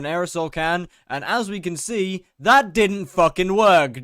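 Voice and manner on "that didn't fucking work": loud and slow, each word dragged out in frustration; exasperated outburst. Real, not acted. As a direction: spit it out, fed up the trick failed. Failed aerosol lighter attempt.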